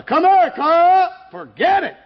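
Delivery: lightly voiced preacher calling loud; high, loud preacher call. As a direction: call him over across the snow. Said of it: "the preacher came out and he said".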